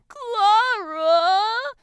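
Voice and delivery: high, loud drawn-out wail; whining. A narrator's reading. Desperate girl to her friend, whining. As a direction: whine the name slowly, high and loud. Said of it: "the girl whines out".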